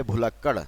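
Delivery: भुलक्कड़ with a short, clipped u after भ; short u demo. Shown as a demonstration. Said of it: "मैं कहता हूँ भुलक्कड़ शब्द है".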